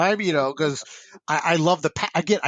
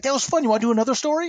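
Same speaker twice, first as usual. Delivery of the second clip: bright jump up in pitch; retold enthusiasm; 10 semitones higher, faster than usual. Artists coming back for another story, enthusiastic. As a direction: imitate keen artists, voice pitched high. Artists eagerly asking for more.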